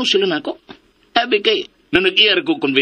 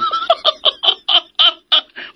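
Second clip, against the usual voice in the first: a high, giddy burst of laughter. Acted. Comic banter, bursts out laughing.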